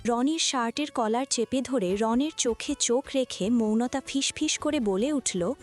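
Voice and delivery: usual speaking voice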